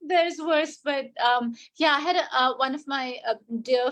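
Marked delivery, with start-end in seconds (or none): none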